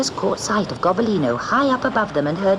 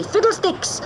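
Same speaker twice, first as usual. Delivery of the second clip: shouting; 14 semitones higher than usual. A narrator's reading. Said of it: "shouting loudly".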